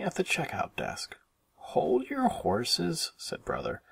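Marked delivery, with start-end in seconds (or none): 1.6-3.1 high, slow drawn-out reply; higher voice of a boy cub stalling; brother's retort. A narrator's reading. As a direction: stretch it out to put off a nagging sister. Brother answers impatient sister, acted.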